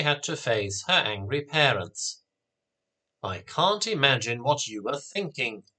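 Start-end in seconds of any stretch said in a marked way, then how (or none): none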